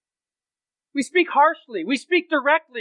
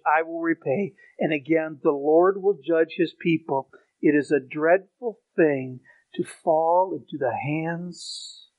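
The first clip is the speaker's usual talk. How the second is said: solemn scripture reading; 10 semitones lower, 4 dB quieter than usual. Acted.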